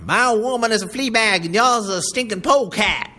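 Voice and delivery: put-on high, loud angry shout in a boy's voice; enraged boy yelling at the driver; furious cry. A narrator's reading. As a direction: lash out at the man. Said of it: "The boy turned angrily".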